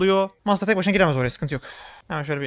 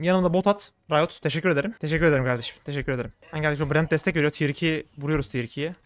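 ordinary talk

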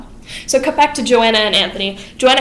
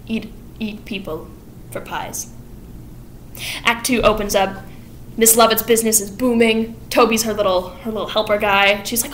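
ordinary talk

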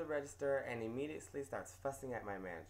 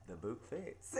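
deadpan; 4 semitones lower, 4 dB quieter, slower than usual. Retold or imitated. Narrator's dry comeback.

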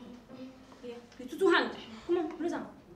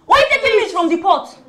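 scolding; 4 semitones higher, 10 dB louder than usual. Acted. Scolding over stolen meat.

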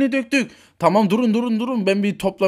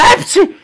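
high, loud sneeze; 12 semitones higher, 10 dB louder than usual. Acted. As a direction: burst out in a squeaky sneeze. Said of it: "hepsi hapşırıyor". (hapşırma)

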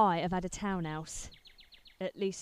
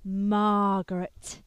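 lingering on the name; 4 dB louder, much slower than usual. Acted. Savouring the girl's name.

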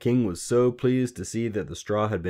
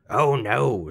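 louder, raised little-man voice; gloating refusal; 5 semitones higher, 7 dB louder than usual. A narrator's reading. Little man rejecting the queen's guess, gloating. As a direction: relish saying no.

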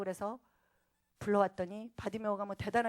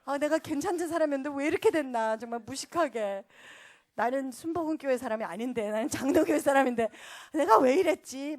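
raised, exclaiming self-reproach; mock regret; 4 semitones higher, 7 dB louder than usual. Church woman regretting her wild night of prayer, exclaiming. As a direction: berate yourself with exaggerated dismay. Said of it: "너무 후회가 되고".

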